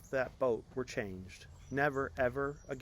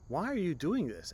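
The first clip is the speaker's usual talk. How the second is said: princely tone; 7 semitones higher, 7 dB louder than usual. Acted. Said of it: "his deep princely tone".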